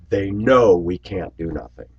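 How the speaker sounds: usual speaking voice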